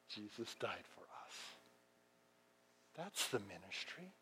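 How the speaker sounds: very soft, low, near-whisper; hushed reverence. Real, not acted. Preacher telling congregation of Jesus' death, hushed and reverent. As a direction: drop right down to a solemn hush.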